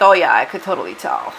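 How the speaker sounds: lightly voiced know-it-all acquaintance; imitating other people. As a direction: drop low and rattle it off like a know-it-all. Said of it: "other people who's like".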